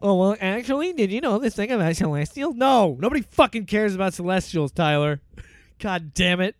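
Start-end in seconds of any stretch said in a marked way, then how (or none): 0.0-2.6 lightly voiced nerdy know-it-all; a hint of a pedantic nerd. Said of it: "That was fun".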